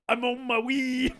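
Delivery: put-on slow sung line in a broad Scottish accent. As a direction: belt it like a Scottish singer. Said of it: "a guy in a very heavy Scottish accent sing".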